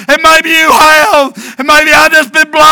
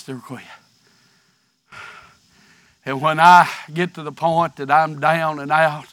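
low, much softer pleading voice; hushed plea; 12 semitones lower, 12 dB quieter, faster than usual. Real, not acted. Preacher begging the congregation not to quit, low and soft. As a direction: drop to a near-whisper and plead with them.

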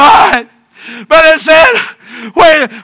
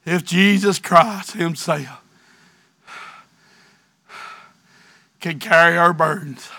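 low, much softer pleading voice; hushed plea; 12 semitones lower, 12 dB quieter, faster than usual. Real, not acted. Preacher begging the congregation not to quit, low and soft. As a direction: drop to a near-whisper and plead with them.

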